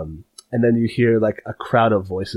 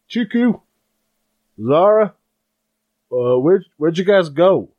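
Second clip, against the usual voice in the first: calling upstairs, higher and louder; 7 semitones higher, 5 dB louder than usual. Acted. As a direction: call up the stairs to find them. Dad calling from downstairs.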